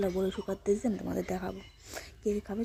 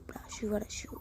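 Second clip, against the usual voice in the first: put-on whispered baby talk; 6 dB quieter, slower than usual. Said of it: "ব্রাশু বারাশু".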